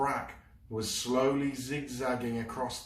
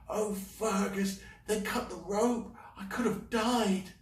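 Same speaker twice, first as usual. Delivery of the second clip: put-on upset girl; 12 semitones higher than usual. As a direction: pour out the fright to a friend. Mouse distressed.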